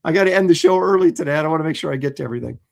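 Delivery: hurried, quick-running delivery; talking fast. Real, not acted. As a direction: rush through it, pressed for time. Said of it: "I'm talking kind of fast".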